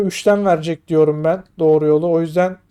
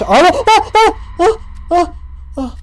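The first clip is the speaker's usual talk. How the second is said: sudden high shrieks, tailing off into gasps; startled screams; 10 semitones higher than usual. Real, not acted. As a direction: yelp in fright, then catch your breath. Startled by a scare in game. (irkilip çığlık atma)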